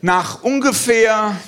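usual speaking voice

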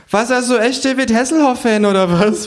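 hohe, laute Figurenstimme; ungläubig hoch nachhaken; Känguru. Acted. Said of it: "fragt das Känguru". (hohe Känguru-Stimme, verblüfft fragend)

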